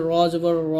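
"র" heavy, with a rounded vowel; thick "র". Shown as a demonstration. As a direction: make each "র" full and thick. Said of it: "জবর এবং পেশ থাকবে র মোটা হবে".

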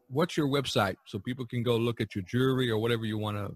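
ordinary talk

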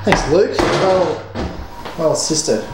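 ordinary talk, nothing marked

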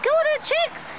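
high sing-song call to hens; calling chickens. Real, not acted.

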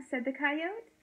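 ordinary delivery

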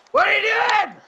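yelling at the screen in disbelief; loud, exasperated yell at a teammate; frustrated shout. Real, not acted. Furious at teammate in game.

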